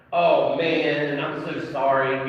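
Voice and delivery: loud, offhand mock of a macho boy; a hammed-up tough-guy sorry; macho teen boy. Imitating macho teenage boys brushing off grief, loud and offhand. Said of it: "boys nowadays, they're all macho".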